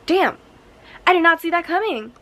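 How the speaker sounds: high, loud exclamation of surprise; astonished reaction. Retold or imitated. Reader summing up the endless twists, astonished. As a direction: burst out as if caught off guard.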